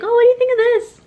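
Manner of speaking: high excited questioning voice; high mocking quote. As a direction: squeal the question. Retold quote, high and loud.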